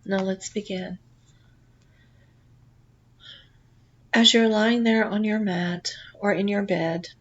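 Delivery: calm guided relaxation read; guided meditation. Acted. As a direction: lull the listener gently into the journey. Leads a guided past life meditation.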